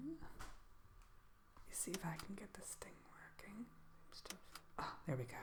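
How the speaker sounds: very soft, half-whispered aside; muttering to oneself. Real, not acted. Shopkeeper to self while getting the thing working, muttering. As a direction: talk under your breath, barely voiced.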